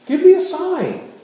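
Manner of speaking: loud, high demand for a sign. Acted. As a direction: insist on it, voice up.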